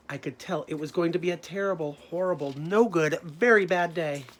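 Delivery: loud, slowing refrain, each word hit; emphatic refrain. Acted. Teacher reading the story refrain, loud and slowing. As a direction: drag out the final words heavily.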